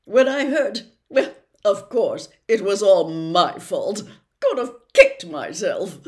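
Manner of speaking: a vain celebrity professor, loud and chummy; booming, self-satisfied charm; Lockhart voice. Acted.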